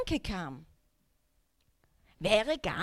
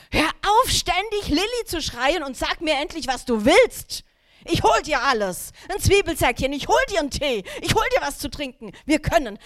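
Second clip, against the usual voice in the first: much louder, higher scolding yell; angry snapping; 4 semitones higher, 7 dB louder than usual. Retold or imitated. Mother snapping at her sick six-year-old, loud angry yell. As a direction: bark at him, patience gone. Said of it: "ich hab's leider in diesem Ton gesagt".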